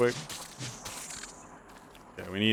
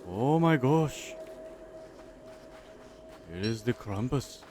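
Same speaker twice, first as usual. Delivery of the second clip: put-on higher, louder mangled Danish-Norwegian-Scottish accent; vague Nordic accent; 6 semitones higher, 5 dB louder, slower than usual. Gamer hyping Krampus to viewers, muddled Nordic accent. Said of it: "I don't even know what accent".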